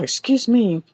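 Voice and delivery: usual speaking voice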